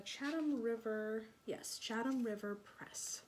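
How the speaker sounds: ordinary delivery, nothing marked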